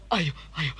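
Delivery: normal talking voice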